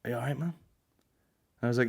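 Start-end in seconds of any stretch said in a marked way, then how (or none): 0.0-0.5 softer, hushed pity; quiet concern. Retold or imitated. The old mate asks softly if he is all right. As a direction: lean in and murmur concern. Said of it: "quietly he's like".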